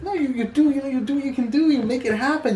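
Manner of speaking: high-pitched, insistent pep talk; acted urging. Teacher answering the half-hearted attitude, urging. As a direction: push each phrase upward, pressing the listener to act.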